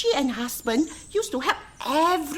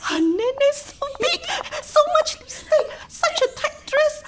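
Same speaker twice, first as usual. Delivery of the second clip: giggling gossip; 11 semitones higher than usual. Acted. Mocking the woman's looks.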